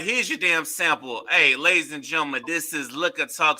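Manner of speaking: ordinary talk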